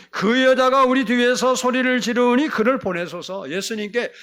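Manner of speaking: loud, high pleading grumble; acted exasperated complaint. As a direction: complain like fed-up disciples. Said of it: "너무 스트레스예요".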